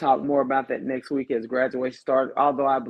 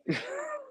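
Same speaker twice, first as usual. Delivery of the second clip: high squeal of laughter; 10 dB quieter than usual. Real, not acted. Host laughing at co-host.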